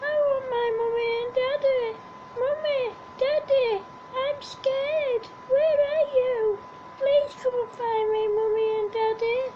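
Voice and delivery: high tearful pleading voice; crying baby bunny. A narrator's reading. Lost bunny crying for her mummy and daddy. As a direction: sob out the plea like a frightened little one. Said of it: "Then she said".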